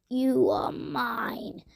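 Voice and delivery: slow hissing witch voice. A narrator's reading. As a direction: hiss it slowly as a witch. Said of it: "hissed the witch".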